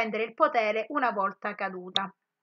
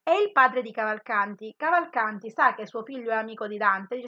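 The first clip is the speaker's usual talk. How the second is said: ordinary talk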